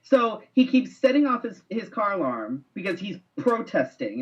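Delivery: usual speaking voice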